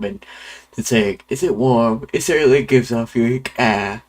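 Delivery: put-on Irish accent for a chatty explorer; Jacksepticeye accent. Game character Quirrell greeting a fellow traveler, in Jacksepticeye's Irish accent. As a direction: read it out in a lively, lilting Irish accent. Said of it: "gave him the Ja-, Jacksepticeye accent".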